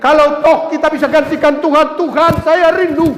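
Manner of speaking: high, loud preaching cry; impassioned preaching. Acted. Preaching climax about God weeping. (khotbah berapi-api, suara tinggi dan keras)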